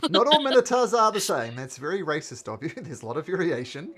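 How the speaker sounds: loud, laughing, high mock offence; laughing mock outrage. Real, not acted. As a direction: fake indignation through laughter.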